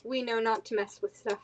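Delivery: normal talking voice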